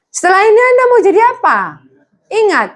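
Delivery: high, loud challenging question; preacher demanding students' plans; preaching call. Real, not acted. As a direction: push the students hard for an answer.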